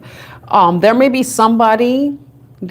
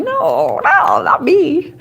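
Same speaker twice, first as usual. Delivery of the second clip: laughing protest; 8 semitones higher, much slower than usual. Retold or imitated. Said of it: "I'm like".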